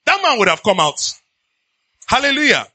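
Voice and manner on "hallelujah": ordinary delivery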